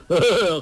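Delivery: loud, high gleeful chuckle; laugh. A narrator's reading. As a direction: burst into a mocking chuckle. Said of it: "周伯通笑道". (高声嘿嘿笑)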